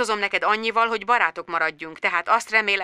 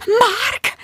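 delighted. A narrator's reading. Joyful surprise.

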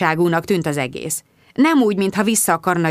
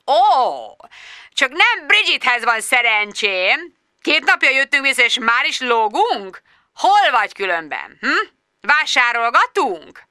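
high, slow mocking boss voice, drawn out; sarcastic boss; 6 semitones higher than usual. Boss mocking Bridget on the phone, high and slow. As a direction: sneer down the phone at the late employee.

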